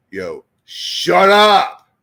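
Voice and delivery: loud, drawn-out mock yell; retold shout. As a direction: yell it as the fed-up listeners. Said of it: "And y'all like".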